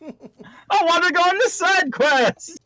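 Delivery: high, slow whiny complaint; hammed-up whiny ranger; whiny slacker. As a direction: whine like a kid skipping work.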